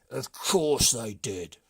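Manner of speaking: loud, slow grumbling chief; the brigand chief, fed up; slow loud complaint from the chief; gruff chief. A narrator's reading. Chief proud.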